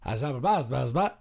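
hammed-up Australian gibberish; mock Australian mumble. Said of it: "joke about Australian accents".

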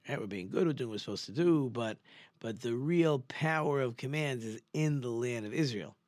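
ordinary delivery

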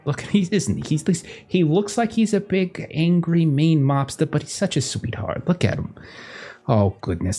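doting socialite. Acted. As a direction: coo over him, soothe the guest. GM voicing doting Evelyn.

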